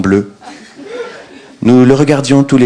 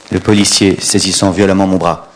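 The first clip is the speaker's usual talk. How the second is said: ordinary talk, nothing marked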